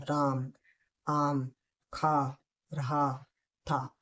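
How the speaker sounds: each word read separately, very slowly. Shown as a demonstration. Teacher demonstrating the second speed, word by word slowly. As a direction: space out every word with pauses. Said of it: "ये मैंने second speed में पढ़ा".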